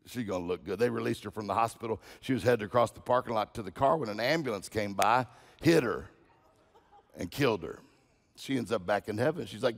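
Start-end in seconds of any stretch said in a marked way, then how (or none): none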